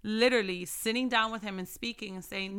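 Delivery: ordinary talk, nothing marked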